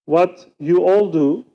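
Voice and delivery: usual speaking voice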